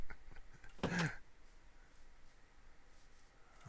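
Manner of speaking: a long, high burst of laughter after the letdown; hearty laugh. Real, not acted. Laughing at his own disappointment with the farm, hearty laugh. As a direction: laugh it off, amused at yourself.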